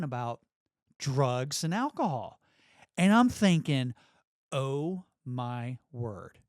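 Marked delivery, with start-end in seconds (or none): 4.5-6.4 very slow, low, word by word; retold dismay. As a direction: let each word drop slowly as dread sinks in. Retold dismayed thought at the speech.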